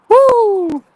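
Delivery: loud high whoop; excited whoop. Real, not acted. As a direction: whoop to hype the crowd. Hyping before heading off.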